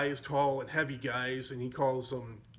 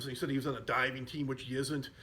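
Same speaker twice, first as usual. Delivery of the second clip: ordinary talk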